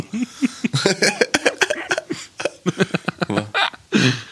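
long high-pitched laugh; high laughter. Real, not acted. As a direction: burst out laughing. Laughing at own joke.

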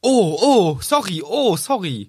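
high, mock-flustered sorries; flustered apology. Acts out Ron's flustered apology. (gespielt hektische, hohe Entschuldigung)

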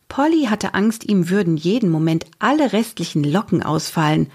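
usual speaking voice

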